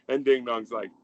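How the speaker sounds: normal talking voice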